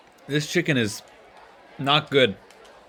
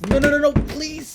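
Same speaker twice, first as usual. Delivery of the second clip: high, loud panicked begging; frantic high-pitched no, no, please; desperate pleading; 11 semitones higher, 6 dB louder than usual. Acted. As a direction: plead frantically at the locked door. Peter begging at school door.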